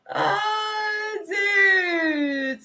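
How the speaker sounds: high-pitched gasp, then a stunned oh dude; real shock at the card. Real, not acted. Reacting to tarot card.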